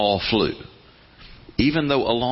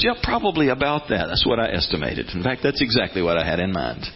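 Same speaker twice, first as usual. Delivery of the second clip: lower, easy unhurried reply; relaxed, lower God voice; calm unbothered God; 6 semitones lower than usual. Retold or imitated. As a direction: shrug it off, all the time in the world. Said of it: "And God goes".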